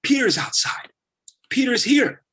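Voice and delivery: high breathless whisper rising to a cry; excited whisper-shout. Acted. Rhoda telling the believers Peter is outside, excited high whisper. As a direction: hiss the news, bursting with excitement. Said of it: "she goes".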